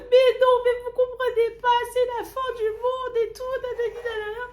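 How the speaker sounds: very high, loud wailing plea; desperate wailing. Retold or imitated. Kneeling in the street, wailing at the woman. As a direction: whine it on your knees in mock despair.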